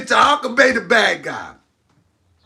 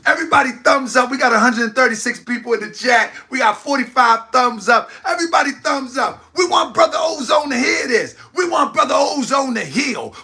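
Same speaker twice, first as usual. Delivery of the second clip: hype host; 4 semitones higher than usual. Acted. Host rallying the live chat for thumbs up, hype call. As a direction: pump up the viewers.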